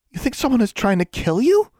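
very high, incredulous question; wide-eyed shock. A narrator's reading. Friend hearing someone wants the girl dead, shocked. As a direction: ask it high in astonishment. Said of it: "Anna's eyes were wide".

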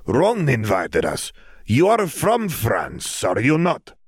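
put-on slow, heavy Eastern European accent; Bulgarian accent. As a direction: speak slowly in Krum's Bulgarian accent. Krum speaks to Hermione.

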